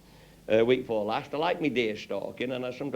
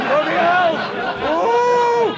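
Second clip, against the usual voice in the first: high, much louder, laughing; laughing exclaim; 11 dB louder, much slower than usual. Real, not acted. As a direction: gasp it through laughter. Laughing at his own story.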